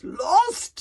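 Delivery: loud, high, rising disbelief; snorting scorn. Acted. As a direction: snort it back as a question. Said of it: "snorted Gobber".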